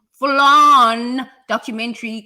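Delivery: loud, stretched opening word; big dramatic build; emphatic. Real, not acted. Speaker warning how soap stories grow into documentaries, loud and emphatic. Hyping story grown into documentary.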